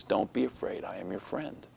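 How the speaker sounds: hushed, comforting voice of a dream; gentle reassurance. Retold or imitated. God reassures a child in a dream, soft and low.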